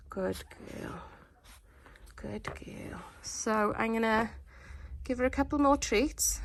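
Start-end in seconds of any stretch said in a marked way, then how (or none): 0.1-1.0 breathy hush to the puppy; soft praise. Real, not acted. Soothing puppy, fading. 2.1-3.0 whispering to the sleepy puppy; whispered praise. Real, not acted.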